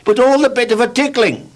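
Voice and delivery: cheerful Mister Tickle. Acted. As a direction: brag happily about those long arms. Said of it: "said Mister Tickle cheerfully".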